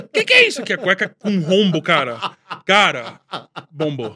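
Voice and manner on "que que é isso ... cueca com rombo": high outraged mother voice; indignant mom. Retold or imitated. (mãe indignada, voz aguda)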